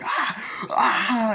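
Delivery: high imitated horror shriek; mock scream. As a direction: scream like the attacked girl, playacted. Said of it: "She starts screaming".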